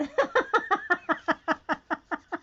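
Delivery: long, high rattling laugh; amused laugh. Real, not acted. Teasing Janet about her answer, cracking up.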